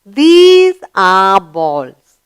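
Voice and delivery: very slow, every word stretched; very slow model. Shown as a demonstration. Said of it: "The pronoun used here is".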